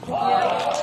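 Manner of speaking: sudden high loud wow; surprised wow. Real, not acted. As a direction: gasp out the wow. Reaction to a holed putt.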